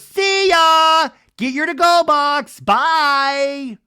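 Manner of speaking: very loud, high drawn-out send-off; mock jeering shout. [name] retold sending Doty off, jeering shout. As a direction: yell a taunting goodbye at someone leaving.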